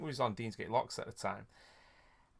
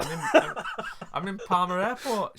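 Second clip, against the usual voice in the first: giggly high confession; 8 semitones higher, 5 dB louder than usual. Retold or imitated. Telling mum where he is.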